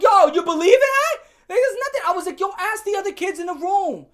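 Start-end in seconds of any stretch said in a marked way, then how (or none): 0.0-2.0 loud, high-pitched outburst of disbelief; indignant protest. Retold or imitated. Student protesting his innocence to Harrison, indignant shouting. 2.4-4.1 raised, high-pitched retold appeal; insistent protest. Student telling Harrison to check with classmates, insistent. Retells pleading his innocence.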